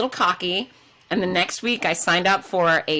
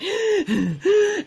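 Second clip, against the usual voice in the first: high, strained gasp for breath; gasping for air; 13 semitones higher than usual. Retold or imitated. Stuck on the steep hill, hyperventilating, a sharp gasp. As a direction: gasp like you can't get air up the hill. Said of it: "and I'm going".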